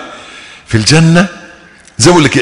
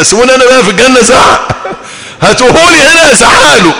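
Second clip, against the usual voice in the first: mock yell; 10 semitones higher, 3 dB louder than usual. Wants to yell at old boss.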